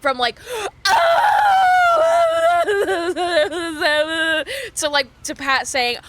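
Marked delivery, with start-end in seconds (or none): 0.4-4.7 loud, high wailing gasp; hammed-up crying gasp, full force; dramatic sob gasp. Switching into fake bawling in front of friends, loud gasping wail. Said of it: "full switch went from, like".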